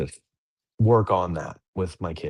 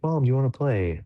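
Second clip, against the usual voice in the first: higher-pitched imitation of a daughter; imitated child; 7 semitones higher, 3 dB louder than usual. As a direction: lightly voice a kid asking to play. Voices daughter asking mom to play.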